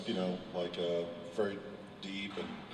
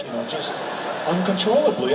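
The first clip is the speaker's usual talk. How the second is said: normal talking voice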